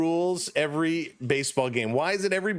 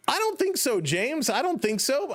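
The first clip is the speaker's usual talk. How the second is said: much higher, playful "no way" retort; mock protest; 9 semitones higher than usual. Real, not acted. Answering viewer James in chat, high mock protest. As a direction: squeak out a teasing denial.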